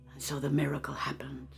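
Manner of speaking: soft, whispery meditation voice; guided meditation. Acted. Teacher invites listeners to feel gratitude: soft meditation voice. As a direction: draw them gently inward.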